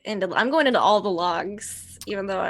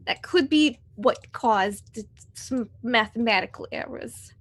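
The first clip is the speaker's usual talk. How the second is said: usual speaking voice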